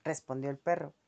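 ordinary delivery

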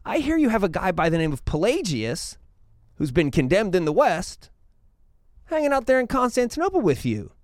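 a veiled threat that turns into open pressure; building threat. Retold or imitated. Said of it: "He goes".

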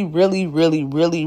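ordinary talk, nothing marked

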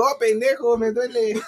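playful hurt protest, close to laughing; mock pained complaint. Storyteller mimics the girl whose bruised eye he poked, mock pained.

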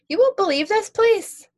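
high, loud excited gush. Acted. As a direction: burst out with delight. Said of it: "he gushed".

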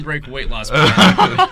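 laughing. Real, not acted. Joke about dessert and diet.

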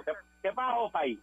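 mock rural Puerto Rican accent. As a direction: fake a rough Puerto Rican jíbaro accent answering the trooper. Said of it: "Con ese acento".

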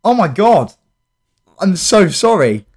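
ordinary talk, nothing marked

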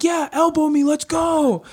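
high, eager taunt; excited retort. Retold or imitated. Fighter taunting his opponent to elbow him, high and eager. Retold cage banter.

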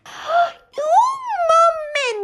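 high, slow, drawn-out protest; indignant high cry. Acted. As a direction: cry out in offended shock.

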